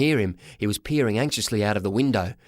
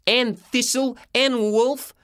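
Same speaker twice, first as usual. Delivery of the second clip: put-on high, slow kid's voice; high-pitched boyish accusation; indignant boy; 12 semitones higher, much slower than usual. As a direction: spell out the culprits in a high voice. Boy character accuses the thieves.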